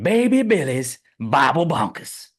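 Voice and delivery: loud, slow announcer voice; game show announcer. Pretend. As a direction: hype it like a TV host. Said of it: "trying to sell Robert Feelings as a game show".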